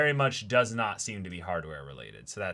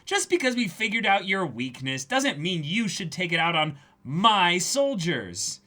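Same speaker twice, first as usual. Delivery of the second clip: higher, louder annoyed snap; mock angry pirate; 9 semitones higher, 7 dB louder than usual. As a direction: tell him off with cartoon anger. Dubbing Nami angry lines.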